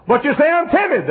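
high, loud quoted objection; mock protest. Preacher loudly mocks the timid listener's excuse. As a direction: toss back their excuse.

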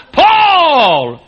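high, loud shout of the name; shouted punchline. Real, not acted. Preacher to congregation, shouting the punchline name. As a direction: fling the name out like an accusation.

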